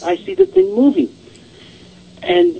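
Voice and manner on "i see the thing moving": imitating her insistence, much higher; high-pitched retold alarm. Woman repeats what she saw on being questioned, retold alarm.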